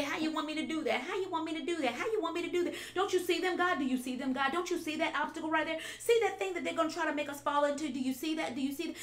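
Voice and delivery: mock the griping believer, rushing; whiny complaining. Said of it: "We will just complain and say".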